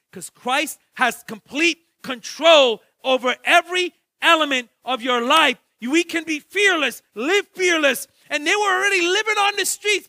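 loud, high preacher's call; full-force preaching. Acted. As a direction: preach it out to the whole church.

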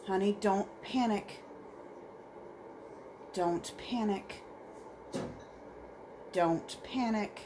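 soft, slow calming repeat; soothing mantra. Real, not acted. Player calming the character underwater, soft and slow. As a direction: murmur it like a mantra. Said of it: "I'm just gonna be repeating that".

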